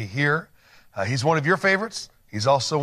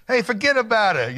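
a light, playful New York "forget about it", pitched higher; host ribbing his guest in a light New York accent; mock New York accent; 8 semitones higher than usual. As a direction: tease him with a hammed-up Brooklyn accent. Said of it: "I also love your New York accent".

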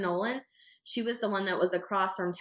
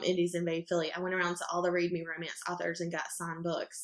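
ordinary talk

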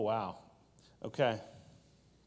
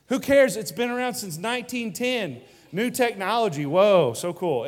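much higher, louder mock enthusiasm; sarcastic mock excitement; 6 semitones higher, 7 dB louder than usual. Pastor mocking the iPhone titanium hype, high and loud sarcasm. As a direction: sneer at the hype, loudly.

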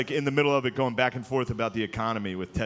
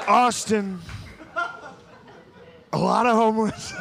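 Trump impression; 8 semitones higher than usual. Shown as a demonstration. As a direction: describe it like Trump would. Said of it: "how to do his voice".

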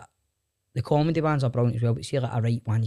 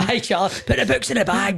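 Sloan catchphrase voice; 9 semitones higher than usual. Retold or imitated. Quoting Sloan's catchphrase, laughing.

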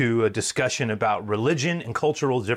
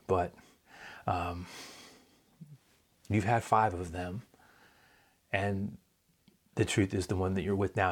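softer, gentle voice of Jesus reassuring her; tender tone; 3 semitones lower, 5 dB quieter than usual. Acted. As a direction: say it tenderly, without shaming her. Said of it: "more in a tone of".

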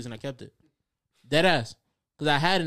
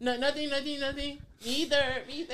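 high mock denial; 10 semitones higher than usual. Mimicking speakers denying.

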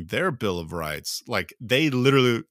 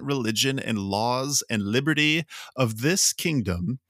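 higher, emphatic proclamation voice; formal reading aloud; 4 semitones higher, 3 dB louder than usual. Real, not acted. Reading the Bill of Rights after giving up the accent, formal. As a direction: read it out like a decree.

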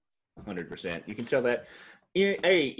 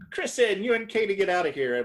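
high, louder mock shout from a balcony; mock yell; 11 semitones higher, 4 dB louder than usual. Scheana imitated yelling down at Kristen and Katie. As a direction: call down the order, hammed up.

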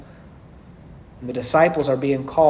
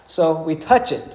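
normal talking voice

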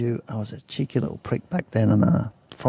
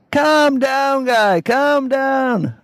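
much higher, drawn-out slow calm down; mocking local guy; 13 semitones higher, 5 dB louder, much slower than usual. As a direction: tease him, very slow and sing-song. Locals mock him to calm down.

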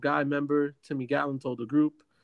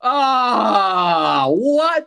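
shocked disbelief; 5 semitones higher, 9 dB louder, much slower than usual. Real, not acted. Hears son's team lost.

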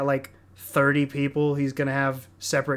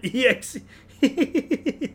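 laughing; 9 semitones higher, much slower than usual. Real, not acted. Laughing repeat of the joke.

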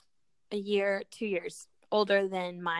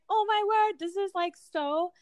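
delighted gush; 8 semitones higher, 4 dB louder, slower than usual. Retold or imitated. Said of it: "that was like".

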